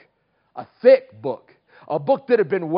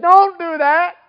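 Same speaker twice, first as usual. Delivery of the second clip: high, loud motherly stop; sharp scold; 12 semitones higher, 11 dB louder than usual. Retold or imitated. Mom stopping her son from pulling weeds wrong, loud and high. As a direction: cut the kid off hard and loud.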